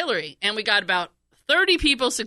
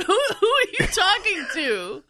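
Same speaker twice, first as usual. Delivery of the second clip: high mock retort; 10 semitones higher than usual. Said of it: "and you said".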